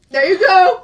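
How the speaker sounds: high, loud burst of approval; excited cheer. Real, not acted. Friend applauding the quiz's clever answer, excited cheer.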